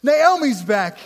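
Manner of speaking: high, loud, slow excited cry; super excited. Retold or imitated. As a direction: cheer her return, drawn out and high. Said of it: "They're super excited to see her".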